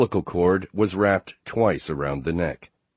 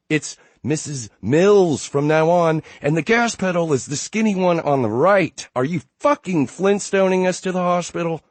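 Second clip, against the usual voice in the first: higher, louder furious yell; angry yelling; 11 semitones higher, 6 dB louder than usual. Acted. Wife yells at driver to hurry.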